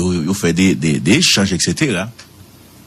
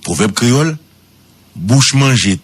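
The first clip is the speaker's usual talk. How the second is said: ordinary talk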